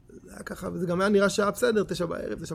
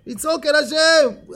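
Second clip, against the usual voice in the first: loud, high, drawn-out yell; shouting to God; 7 semitones higher, 6 dB louder, slower than usual. Shown as a demonstration. Teacher demonstrates crying out to God, shouting. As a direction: yell it slowly like a street punk. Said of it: "כמו פושטק".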